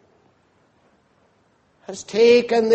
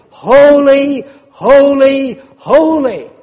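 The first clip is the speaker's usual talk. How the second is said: ringing, much louder call, each holy falling; proclaimed holy cry; 4 semitones higher, 9 dB louder, slower than usual. Acted. Preacher voicing angels around the throne, loud ringing proclamation.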